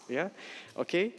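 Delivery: normal talking voice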